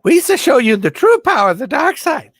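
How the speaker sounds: very high, loud silly villain; Jar Jar-style Darth Vader. Acted. Villain luring someone to the dark side, high goofy alien. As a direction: tempt in a goofy alien squeal. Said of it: "Not unless he changes his voice".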